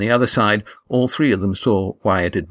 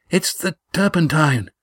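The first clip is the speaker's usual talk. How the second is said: higher-pitched gasped exclamation; 7 semitones higher than usual. A narrator's reading. Lindy fleeing an explosion with her companions, gasped. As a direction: gasp it out in alarm on the run. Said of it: "gasped Lindy".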